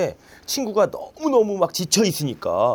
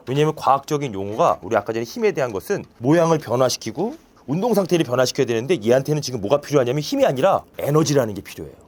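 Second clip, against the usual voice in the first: usual speaking voice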